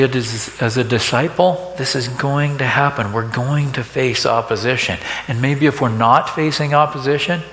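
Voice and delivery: ordinary talk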